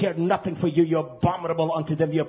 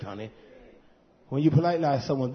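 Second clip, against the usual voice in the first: normal talking voice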